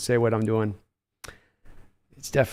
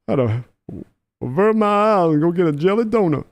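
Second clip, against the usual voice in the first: Charles impression; 2 semitones higher than usual. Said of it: "Charles is a real tough one".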